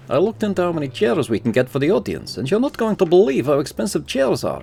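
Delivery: lightly voiced upbeat character; game character voice. Voices shop NPC in game.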